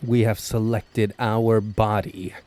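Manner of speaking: ordinary talk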